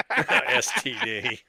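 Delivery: drawn-out laughing repeat; slow laughing echo. Real, not acted. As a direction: stretch the letters out while laughing.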